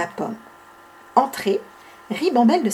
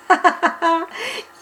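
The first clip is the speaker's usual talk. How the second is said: sly giggle; 5 semitones higher than usual. Acted. Bibi laughs at tricked friends.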